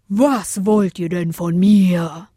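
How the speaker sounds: slow, drawn-out bellow; heavy, slow roar; roaring big wind. A narrator's reading. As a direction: boom it out like an irritated giant. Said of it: "brüllte der große Wind".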